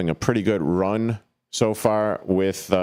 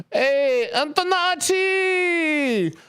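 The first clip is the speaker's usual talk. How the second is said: high, stretched cheer of Antonacci; stadium call; much slower than usual. Acted. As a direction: belt the name out like a fan in the stands. Calling out player's name.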